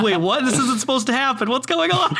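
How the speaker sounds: rising, baffled "wait, what?"; surprised disbelief. Retold or imitated. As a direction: pitch climbing in shock. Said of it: "And I'm like".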